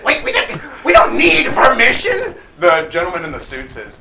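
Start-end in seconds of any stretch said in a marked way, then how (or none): 0.0-2.3 high, loud, stumbling protest; indignant kobold bandit. Acted. Bandit realising he needs no permission, high indignation.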